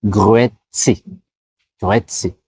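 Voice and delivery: "Grüezi" dit "grüétsé", R roulé; répète "Grüezi" en roulant le R; salut formel suisse. Shown as a demonstration. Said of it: "Ils vont dire Grüezi". ("Grüezi" prononcé "grüétsé")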